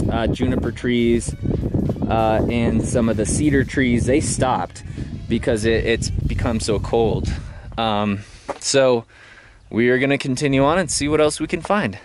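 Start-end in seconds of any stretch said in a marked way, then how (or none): none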